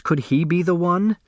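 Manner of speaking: ordinary delivery, nothing marked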